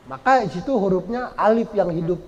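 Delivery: normal talking voice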